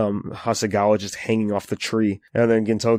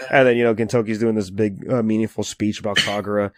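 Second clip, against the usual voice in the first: ordinary delivery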